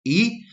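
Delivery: "E" said as an ee vowel; isolated "E", rising in pitch; contrasting E sound. Shown as a demonstration. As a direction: give "E" as the sound to avoid, ee. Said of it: "not E".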